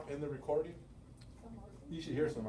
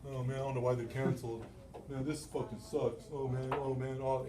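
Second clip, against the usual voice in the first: mock gloomy grumble. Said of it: "some people, it's like".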